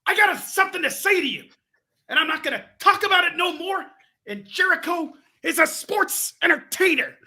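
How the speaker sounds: very high, loud mock promo yell; Daddy Magic. Co-host imitating the wrestler cutting a promo, in a high hammed-up yell. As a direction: bellow it like a crazed wrestler. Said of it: "because he'll yell".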